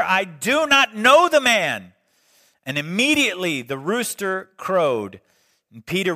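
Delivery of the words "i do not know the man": loud, raised, emphatic oath; vehement sworn denial. Retold or imitated. Preacher voicing Peter denying Jesus to his accusers, vehement denial. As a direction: swear it forcefully, voice lifted. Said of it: "invoke a curse on himself and to swear".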